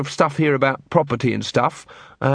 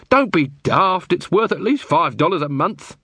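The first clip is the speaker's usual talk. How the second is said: higher-pitched scoff; exasperated Muldoon; 6 semitones higher than usual. Acted.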